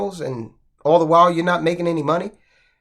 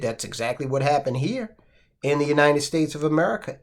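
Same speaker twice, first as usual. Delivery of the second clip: normal talking voice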